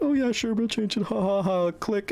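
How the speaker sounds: high, sing-song fake cheerfulness with a mock laugh; mocking dismissive staff. School staff brushing off mom.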